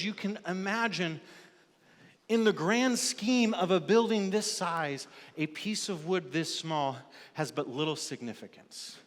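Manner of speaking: ordinary talk, nothing marked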